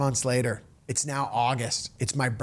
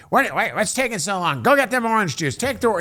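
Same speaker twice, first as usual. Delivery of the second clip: higher, louder impatient nagging; nagging boss; 7 semitones higher, 4 dB louder than usual. Retold or imitated. Boss Henny nags the young host about orange juice, higher and louder. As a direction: hurry him along, pushy and shrill in pitch.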